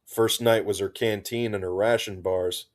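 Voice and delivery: ordinary delivery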